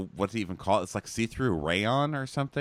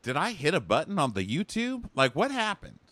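mock confusion; 6 semitones higher than usual. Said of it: "Sometimes I'm like".

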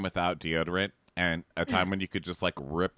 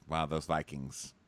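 usual speaking voice

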